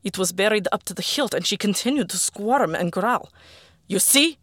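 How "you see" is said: high, loud challenging shout. Acted. Arkady stabbing the creature before the men, shouting. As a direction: shout it right at them. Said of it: "he shouted".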